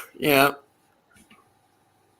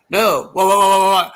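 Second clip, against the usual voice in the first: Moe impression; 5 semitones higher, 3 dB louder than usual. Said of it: "and then Mo goes".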